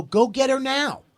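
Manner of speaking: high, loud, drawn-out command; retold urgent order. Boss sending staff after the woman, urgent loud order. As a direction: send them off urgently, stretching it high.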